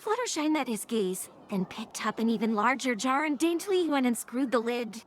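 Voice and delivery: usual speaking voice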